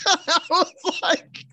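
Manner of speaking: high laughing, slowed; can't get it out for laughing; real laughter through the words. Real, not acted. Laughing while retelling.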